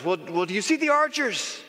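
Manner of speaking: high, barking boss voice; impatient commander calling up to the wall; boss questioning. Acted. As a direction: play the demanding boss up high. Boss voiced in imagined scene.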